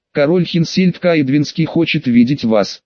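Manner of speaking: usual speaking voice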